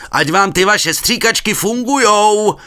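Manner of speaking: slow loud high yell; shouting across the water. A narrator's reading. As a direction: call it far over the river. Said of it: "zakřičel přes vodu".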